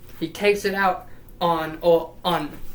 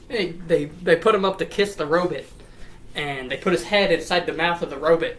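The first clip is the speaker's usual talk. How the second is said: ordinary talk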